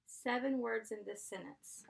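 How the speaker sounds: ordinary delivery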